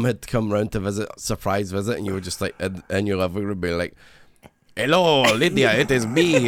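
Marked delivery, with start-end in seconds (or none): 4.8-6.5 higher, lightly voiced Eastern European accent; lightly voiced Eastern European cat voice. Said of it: "That's literally how I make him talk".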